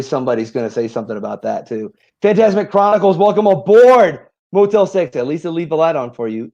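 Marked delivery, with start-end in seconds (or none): 2.2-5.1 announcer voice. Acted. Reads chat names like announcer.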